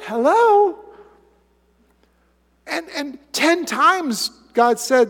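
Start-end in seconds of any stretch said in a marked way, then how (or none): 0.0-0.8 sarcastic hello. Real, not acted. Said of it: "mocking rhetorical jab".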